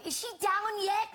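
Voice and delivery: high, loud call across the studio; yelled. Retold or imitated. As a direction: shout it from far off.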